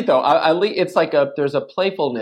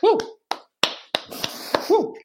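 quiet woo. Shown as a demonstration. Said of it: "I go like this because baby is sleeping".

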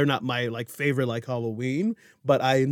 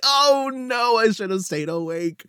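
mock dismay; 9 semitones higher than usual. Child self dismayed.

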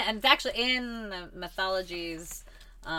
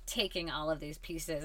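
ordinary delivery

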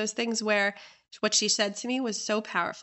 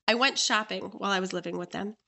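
normal talking voice

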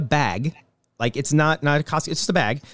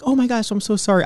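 high-pitched mock apologetic; put-on apology, pitched up; mock meek apology; 7 semitones higher than usual. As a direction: play a meek, over-sorry customer. Mocks meekly accepting rude service.

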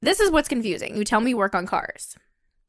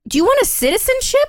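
incredulous question; 13 semitones higher, 4 dB louder than usual. Retold or imitated. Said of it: "And I even said it like that".

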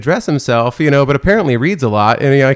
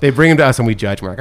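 ordinary delivery

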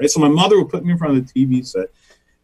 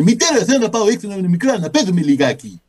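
Bullwinkle in Greek; 7 semitones higher, faster than usual. Retold or imitated. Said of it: "I would do Bullwinkle in Greek".

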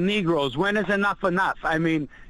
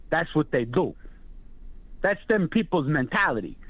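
ordinary talk

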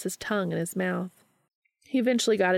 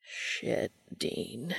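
much softer, low breathy murmur; 8 semitones lower, 7 dB quieter than usual. A narrator's reading. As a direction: let it slip out under the breath. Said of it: "he murmured".

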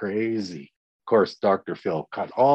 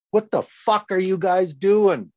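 imitating a coach yelling much higher and louder; hollering coach; 9 semitones higher, 3 dB louder than usual. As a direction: bark at the players like an angry coach. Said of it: "Were they hollering?".

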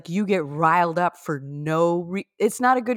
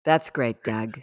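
dismissive play line; 10 semitones lower, much slower than usual. Acted. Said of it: "all she says is".

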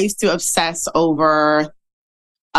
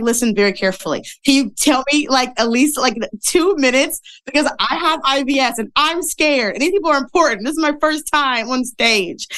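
nervous pleading; 6 semitones higher than usual. Retold or imitated. Pleading before first talk.

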